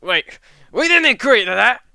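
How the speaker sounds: high, loud objection; hammed-up indignant outcry; alarmed protest. Beaten fighter protests the theft.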